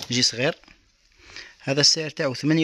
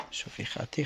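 a quick hushed whisper to auntie; whispered aside; 10 dB quieter than usual. Real, not acted. Seller whispering to auntie viewer, hushed. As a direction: lean in and whisper it.

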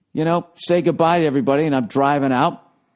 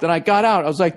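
normal talking voice

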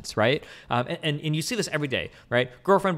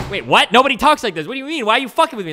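high, loud baffled outburst, voice cracking up; incredulous yell; 10 semitones higher, 9 dB louder, faster than usual. Retold or imitated. Friend reacting to a literal-minded reply, in a loud incredulous yell. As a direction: yell it like you can't believe your ears.

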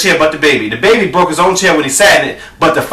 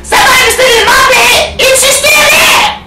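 very high squealing cub; Baby Bear; 3 dB louder than usual. Acted. Little bear finding someone in bed, high-pitched squeal. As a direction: shriek it in a tiny bear's squeal. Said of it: "Baby Bear says".

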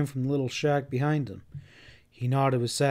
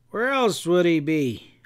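bitter; 4 semitones higher, 4 dB louder, slower than usual. A narrator's reading. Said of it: "Turner asked bitterly".

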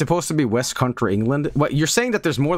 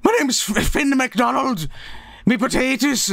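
high-pitched, hammed-up Irish farmer's brogue; mock Irish accent; 12 semitones higher than usual. Streamer mocking a game character's accent, exaggerated high Irish voice. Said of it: "what part of Ireland is that specifically".

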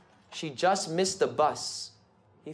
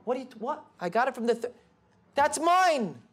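crying; 7 semitones higher, faster than usual. A narrator's reading. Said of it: "She's crying".

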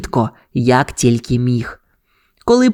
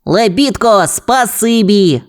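higher-pitched, drawn-out grateful cry; pup's exclamation; 7 semitones higher, slower than usual. A narrator's reading. Pup thanks the winch, high drawn-out exclamation. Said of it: "вигукнув гонщик".

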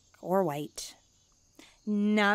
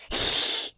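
startled gasp. Acted.

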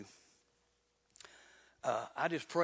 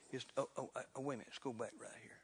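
whispered aside; 3 semitones lower, 9 dB quieter, much faster than usual. Real, not acted. Preacher loses place, quick aside.

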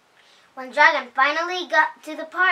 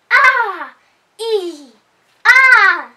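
higher, louder string of frightened shrieks; the dragon scares himself and screams; storybook panic screams; scared screams; 8 semitones higher, 10 dB louder, much slower than usual. Acted. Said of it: "screamed the dragon".

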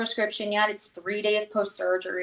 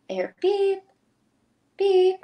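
a much higher "beep, beep" like a hospital monitor; monitor beep imitation; 11 semitones higher, 4 dB louder than usual. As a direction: chirp "beep" twice like the machine by the bed.

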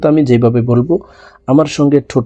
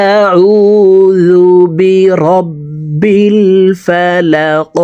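slow melodic recitation, the repeat sung higher; full verse recitation; 6 semitones higher, 3 dB louder, much slower than usual. Shown as a demonstration. Teacher reciting the full verse for students. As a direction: chant the whole verse. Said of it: "সম্পূর্ণ আয়াত বলে দিচ্ছি".

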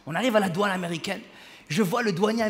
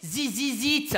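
put-on French-accented English; 3 semitones higher, much slower than usual. Lady naming [name]'s tour, heavy French accent. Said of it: "je pense qu'elle parlait de This Is It".

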